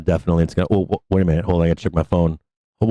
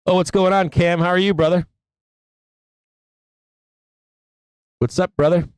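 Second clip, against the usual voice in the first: excited greeting; 8 semitones higher than usual. Real, not acted. Greeting chat viewer.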